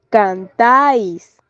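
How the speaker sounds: "cantáis" slowly, TÁIS stretched and stressed; "cantáis" as a slow demo, weight on TÁIS; stress on last syllable. Shown as a demonstration. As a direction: draw out the end of "cantáis". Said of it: "esta marca la posición del acento".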